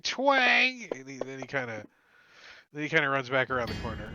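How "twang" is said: high, loud mouth-made twang; vocal bowstring sound effect. Acted. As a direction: imitate a bow firing. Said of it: "He's like".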